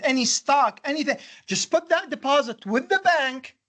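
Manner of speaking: high-pitched, animated exclaiming at the class; worked-up urging. Real, not acted. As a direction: jolt a sleepy class awake. Urging class to react to twenty-two percent.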